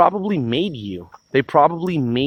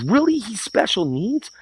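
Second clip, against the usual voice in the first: sharp high disbelieving really; incredulous; 6 semitones higher than usual. Real, not acted. Answering critics, high incredulous. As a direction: jump up in mock disbelief.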